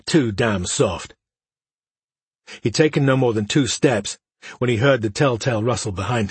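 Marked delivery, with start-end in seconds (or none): 0.1-1.1 slow, tired sigh of a line; weary. Acted. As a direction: drag it out wearily. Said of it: "he thought wearily".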